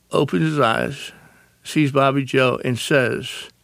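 normal talking voice

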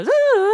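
high, loud ambulance siren imitation; mock siren hooting; siren wail. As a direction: wail like an ambulance going past. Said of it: "Another one's died".

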